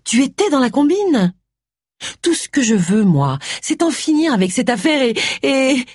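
high, upset voice nearing tears; accuse, then falter as tears threaten; building to tears. A narrator's reading. Agatha accuses Charles before breaking into sobs, high upset voice. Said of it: "elle éclata en sanglots".

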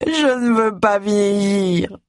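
slow, high, tearful plea; a mild, understated sob, drawn out slowly; sobbing. Acted. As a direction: sob it out like a woman afraid of ageing. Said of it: "sanglota-t-elle".

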